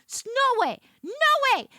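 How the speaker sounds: loud, high, disbelieving no way; indignant outburst. Real, not acted. Ranting to the audience, an indignant loud high cry. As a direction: fling it out in outrage.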